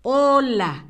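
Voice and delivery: usual speaking voice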